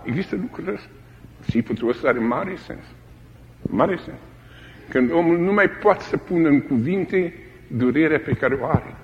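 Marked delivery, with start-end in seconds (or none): none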